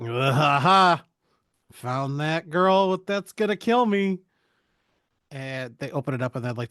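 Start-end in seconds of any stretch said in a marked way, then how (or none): 0.0-4.2 hammed-up evil gloating; lightly voiced villain with a fake cackle; mock villainess.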